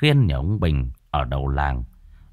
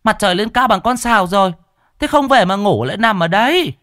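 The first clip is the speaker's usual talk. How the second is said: high, loud, fast nagging wife; loud scolding call; 6 dB louder than usual. Acted. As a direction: bawl him out from far off. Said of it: "tiếng vợ ở trên đê oang oang".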